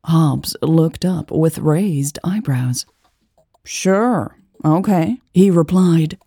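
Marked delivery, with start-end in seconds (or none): 3.6-5.2 offhand, like talking to a buddy; casual shrug. Acted. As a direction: toss it off with a shrug. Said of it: "as if he was talking to one of his buddies".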